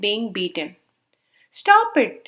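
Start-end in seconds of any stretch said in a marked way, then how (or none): 1.6-2.3 sharp high cry of protest, louder. A narrator's reading. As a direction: cry out to stop him, pitched up. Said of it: "she cried".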